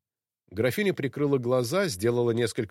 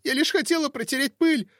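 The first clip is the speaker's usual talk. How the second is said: frightened plea; 4 dB louder than usual. Acted. Frightened maid excuses herself.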